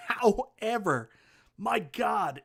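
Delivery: a drawn-out "however" with a laugh in it. Real, not acted. Laughing at the cabinet's flaw.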